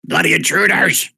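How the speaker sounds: loud, high battle cry; shouted alarm at intruders; screaming Duergar. Acted. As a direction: yell it as an angry dwarf raider. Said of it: "they start screaming in Duergar".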